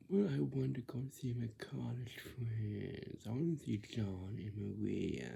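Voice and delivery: demonstrating a dragging, complaining tone. Shown as a demonstration. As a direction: stretch the words like a complaint. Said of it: "It's complaining".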